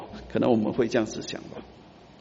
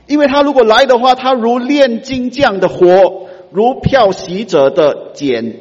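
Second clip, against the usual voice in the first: much louder, higher, slow proclamation; preaching at full force; 5 semitones higher, 7 dB louder, slower than usual. Acted. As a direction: thunder the scripture over the church. (激昂地宣讲经文)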